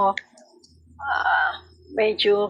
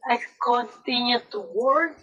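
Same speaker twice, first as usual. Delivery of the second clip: daughter's words retold slowly, dwelling on each; slow retold quote; slower than usual. Mother slowly repeating her daughter's complaint, pained. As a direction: let the hurt words drag out. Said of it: "sabi niya".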